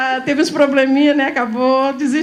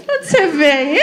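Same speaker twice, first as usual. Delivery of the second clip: laughing question; 3 semitones higher than usual. Retold or imitated. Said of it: "falamos assim".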